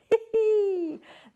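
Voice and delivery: a high, loud burst of laughter. Real, not acted. Delighted by the Kylie koala stamp.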